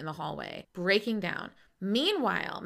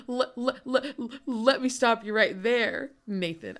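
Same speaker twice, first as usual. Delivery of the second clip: [name]'s voice; 3 semitones higher, 5 dB louder than usual. Retold or imitated. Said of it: "[name], is like".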